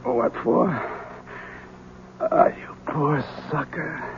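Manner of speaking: weak, slow fading voice; last breaths, bitter and spent; dying, fading. Acted.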